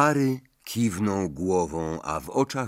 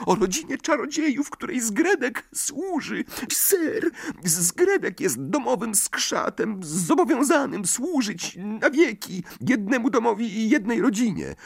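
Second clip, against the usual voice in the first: very high, earnest elf voice; a tiny servant fretting; squeaky house-elf; 14 semitones higher than usual. A narrator's reading. Dobby explains. (piskliwy głos skrzata)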